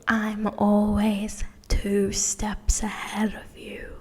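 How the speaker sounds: slow, menacing whisper; slow creepy whisper imitating a villain; creepy villain quote. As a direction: whisper it slowly like a sinister stalker. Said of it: "Him going".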